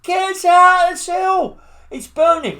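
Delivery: high, loud, slow cry of alarm; retold panicked shout. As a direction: yell it like a prisoner trapped in a burning cell. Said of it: "shouting out".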